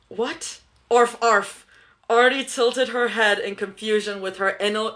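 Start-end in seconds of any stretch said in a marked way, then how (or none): 0.9-1.7 dog barks, arf arf; playful barking. Pretend. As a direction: bark like a playful pup. Inner wolf barking.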